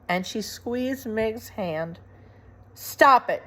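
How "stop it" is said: louder, higher angry rat; shout. Acted. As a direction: order them to stop. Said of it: "shouted Roscuro".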